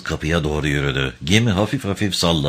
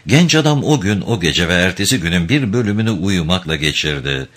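ordinary delivery, nothing marked

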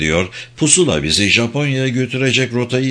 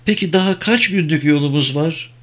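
higher-pitched, mildly cheerful question; cheered up; 5 semitones higher than usual. A narrator's reading. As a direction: ask with a touch of renewed cheer. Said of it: "Neşelendi". (hafif neşelenmiş bir sesle)

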